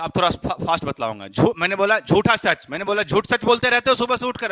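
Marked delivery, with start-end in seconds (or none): 1.6-4.5 loud, high classroom drill call. Shown as a demonstration. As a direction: belt it out to the class. Teacher drills mnemonic loudly.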